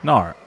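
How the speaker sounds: "Gnar" as a drawn Australian "no"; put-on Aussie vowel on "Gnar"; Australian-style "no". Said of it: "Did I do that right?".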